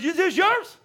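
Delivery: high, laughing mock-stern question; dad laughing as he asks his son. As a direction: hold up the broken watch, laughing. Finding the broken watch.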